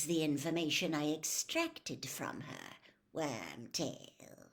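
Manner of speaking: lightly voiced cold, commanding lord; calm menace handing out orders; a dark lord instructing his servant; cold master. A narrator's reading. The cold lord explains the killing.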